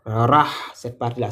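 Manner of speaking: usual speaking voice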